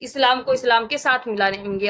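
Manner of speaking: ordinary talk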